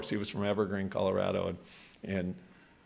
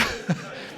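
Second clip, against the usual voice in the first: short voiceless laugh; quick wheezing chuckle; real laughter, no voice; breathless laugh; 4 dB quieter than usual. Real, not acted. Laughing at own story.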